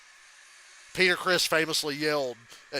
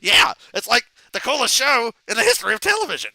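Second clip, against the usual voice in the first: loud, higher-pitched excited Beavis; Beavis impression; 9 semitones higher, 11 dB louder than usual. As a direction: yell it like hyped-up Beavis. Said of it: "And then Beavis goes".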